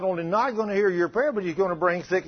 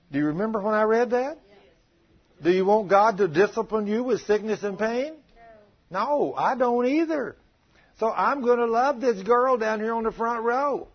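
higher, louder preaching voice; fervent preaching; 7 semitones higher, 4 dB louder than usual. Acted. Preacher urging the congregation to love their wives, fervent. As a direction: rouse the congregation with rising fervour.

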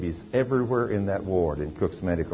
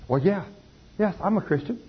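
put-on young mother's reply; 11 semitones higher than usual. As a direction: voice the mother much higher, defensive. Preacher voices the young mother.

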